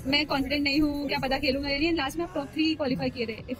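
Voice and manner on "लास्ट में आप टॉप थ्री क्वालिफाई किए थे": usual speaking voice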